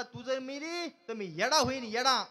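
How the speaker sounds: milk the promise, land hard on येडा; melodramatic husband. Acted. Husband repeats declaration dramatically. (नाटकी नवरा, "येडा" जोरात आणि सावकाश)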